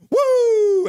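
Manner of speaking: high, loud fan's whoop. Retold or imitated. Voicing a chatter's cheer, a loud high whoop. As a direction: let out a big high woo. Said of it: "And then Aiden Kelly goes".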